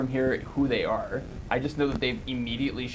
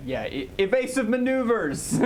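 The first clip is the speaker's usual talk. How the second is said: excited shout; 10 semitones higher than usual. Acted. Panicked call in ship chase.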